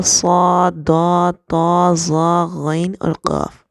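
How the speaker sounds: each letter said thick and full, صاد، داد، طا; heavy letters. Shown as a demonstration. Teacher reciting the heavy letters to a class. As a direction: sound out the heavy letters slowly. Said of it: "قرآن پاک میں موٹے پڑھے جاتے ہیں".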